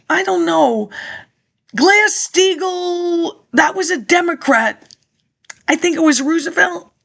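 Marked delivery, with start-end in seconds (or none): none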